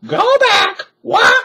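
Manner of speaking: very high, loud parrot squawk. A narrator's reading. Parrot warns the children, high squawk. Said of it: "squawked Polly".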